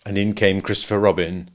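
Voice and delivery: ordinary delivery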